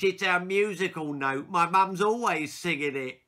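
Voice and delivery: loud, high cheeky explanation; girl explains the joke to the class; smug girl. Acted. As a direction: act innocent while teasing.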